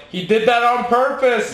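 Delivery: high, drawn-out protesting shout; mock heckle from the crowd; retold yell. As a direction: yell it slowly like an outraged spectator. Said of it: "I remember I yelled".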